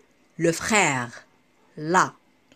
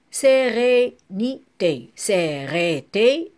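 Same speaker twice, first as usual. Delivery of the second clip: slow, emphatic split of "Sérénité"; syllable by syllable; 3 semitones higher, 4 dB louder, much slower than usual. Shown as a demonstration. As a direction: cut SÉRÉNITÉ into distinct syllables. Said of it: "couper le mot par syllabe".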